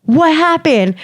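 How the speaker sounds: high, startled group reaction; mock shocked. Imitates friends' shock at the slap.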